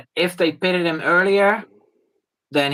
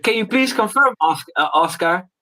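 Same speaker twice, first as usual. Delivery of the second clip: mock radio engineer; 7 semitones higher than usual. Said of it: "I said".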